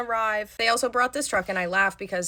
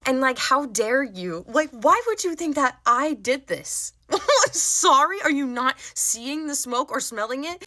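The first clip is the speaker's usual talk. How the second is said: indignant; 6 semitones higher than usual. Retold or imitated. Indignant rant at customer.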